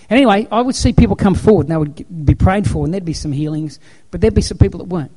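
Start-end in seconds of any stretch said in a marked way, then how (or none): none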